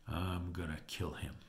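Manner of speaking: low, soft acted threat; quiet fury. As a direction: say it low, half to yourself. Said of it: "he was furious".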